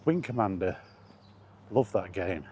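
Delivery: usual speaking voice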